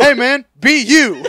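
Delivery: high, loud, slow put-on Mark Norman; loud, slow impression of Mark Norman. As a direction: belt it like a goofy comic impression of Mark Norman. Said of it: "ingrained in my brain to just be Mark Norman".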